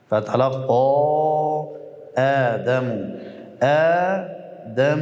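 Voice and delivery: فتلقا آدم recited slowly, vowels stretched; Quran recitation demo. Shown as a demonstration. Teacher recites فتلقا آدم for the class. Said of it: "مد طبیعی".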